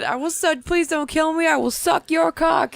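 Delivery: high, loud, slow begging; frantic pleading. Retold or imitated. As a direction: beg frantically, nearly shouting. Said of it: "Oh my God".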